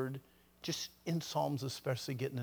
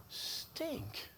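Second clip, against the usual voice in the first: retold disappointment; 10 semitones higher, 9 dB quieter than usual. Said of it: "My first thought was".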